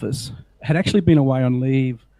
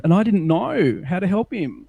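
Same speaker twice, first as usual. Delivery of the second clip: normal talking voice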